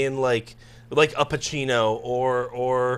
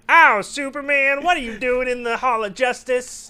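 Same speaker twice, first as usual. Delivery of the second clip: slow, high, drawn-out comic voice; hammed-up high-pitched question; high cartoon superhero voice; 8 semitones higher than usual. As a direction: exclaim it like a silly cartoon hero. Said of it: "If you do any voices".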